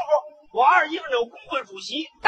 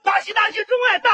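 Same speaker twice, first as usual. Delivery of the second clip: big-voiced congratulations; 8 semitones higher than usual. Best man bursting into the bride's home, shouting congratulations. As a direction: bellow the wedding congratulations. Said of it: "大嗓门道喜嚷啊".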